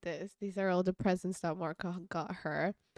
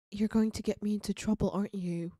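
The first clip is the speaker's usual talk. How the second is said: usual speaking voice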